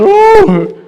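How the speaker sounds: electrician's startled cry, caught peeking. Retold or imitated. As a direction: yelp it, caught out. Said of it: "And he said".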